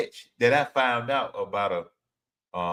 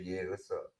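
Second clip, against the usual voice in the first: low, hushed smooth-guy phone voice; deep phone voice; 7 semitones lower, 16 dB quieter, slower than usual. Retold or imitated. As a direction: drop it low and soft for a crush. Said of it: "get our deep voices".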